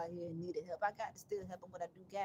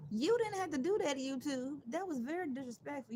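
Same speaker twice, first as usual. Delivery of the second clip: mock scolding; 4 semitones higher, 5 dB louder than usual. Real, not acted. Scolding YouTube for pausing.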